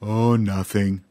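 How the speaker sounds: slow sighing sad elephant. A narrator's reading. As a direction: sigh it out, feeling plain. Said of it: "Dumphy said with a sigh".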